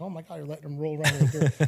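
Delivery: quick, laughing imitation of a horrified wife; mock appalled wife. Dad imitating his wife catching the kids in dirt, laughing mock horror. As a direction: toss off the wife's shocked outburst with a laugh. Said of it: "She might be like".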